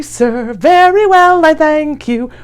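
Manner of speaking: ordinary delivery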